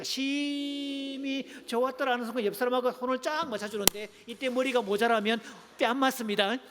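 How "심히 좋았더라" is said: much higher, slow, stretched 좋았더라 with joy; drawn-out exclaim. Shown as a demonstration. Pastor leading the church in a call-and-response, exultant. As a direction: sing out 좋았더라 slowly and high.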